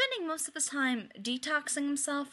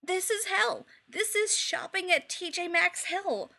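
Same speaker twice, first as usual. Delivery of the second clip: melodramatic despair; 7 semitones higher than usual. A narrator's reading.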